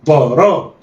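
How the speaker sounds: ضا را snatched together; blended quickly. Shown as a demonstration. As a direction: run ضا and را into one. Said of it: "يلا، اخطف".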